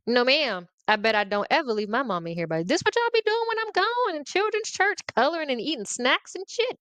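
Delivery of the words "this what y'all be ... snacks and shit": much higher, indignant voice; outraged disbelief. Retold or imitated. A child accusing the adults, high and indignant. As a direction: sound scandalized at the idea. Outraged at being sent away.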